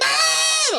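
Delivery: high-pitched mock scream; imitated scream. Co-host imagining the host's reaction, imitated scream. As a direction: shriek like a panicked driver. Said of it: "screaming, like".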